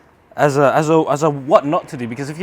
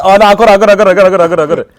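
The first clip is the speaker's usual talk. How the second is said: excited; 3 semitones higher, 9 dB louder, much faster than usual. Real, not acted. Catching the falling plug.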